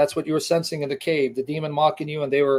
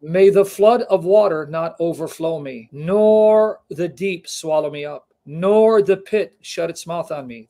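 slow, louder psalm recitation; fervent Bible reading; solemn slow scripture reading; 5 dB louder, slower than usual. Acted. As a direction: draw out each plea. Reads psalm aloud, slow.

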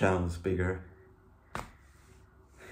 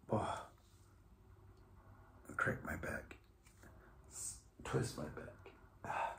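strained whisper; 3 semitones lower, 15 dB quieter than usual. Real, not acted. Stretching after push-ups.

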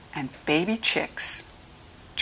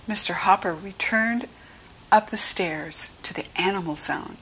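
plain reading voice

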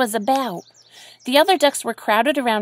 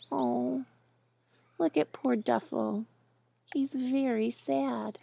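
put-on soft, sympathetic aside to a child; tender pity; 9 dB quieter than usual. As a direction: say it gently and quietly, feeling sorry. Reader pities the sad duck.

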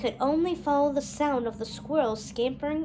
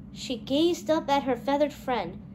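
usual speaking voice

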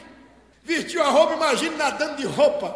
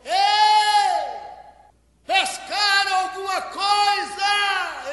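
long, high call across the water; drawn-out high-pitched hail; shouting from the shore; 8 semitones higher, slower than usual. Acted. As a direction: holler to someone far off.

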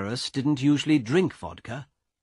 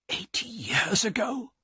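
breathless whisper of shock; a stunned man whispering to the guard; 10 semitones higher, 3 dB quieter than usual. Acted. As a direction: whisper it in dread. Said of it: "he whispered".

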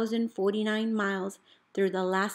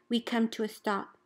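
usual speaking voice

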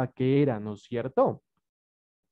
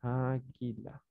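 very soft, slow "Águila", syllables drawn out; dictating; 3 semitones lower, 10 dB quieter, slower than usual. Shown as a demonstration. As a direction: murmur "Águila" slowly while writing it. Sounds word out while writing it.

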